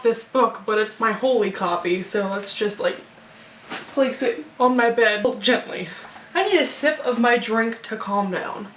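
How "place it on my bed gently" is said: ordinary talk, nothing marked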